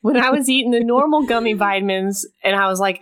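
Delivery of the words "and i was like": usual speaking voice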